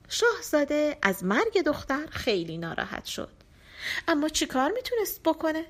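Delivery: ordinary delivery, nothing marked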